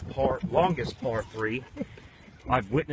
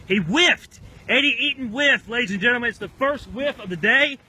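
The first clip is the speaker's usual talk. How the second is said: loud, much higher disbelieving call; hammed-up golf announcer over a whiff; excited commentary; 11 semitones higher, 10 dB louder than usual. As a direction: shout the miss like a stunned commentator. Mock commentator reacts to whiff.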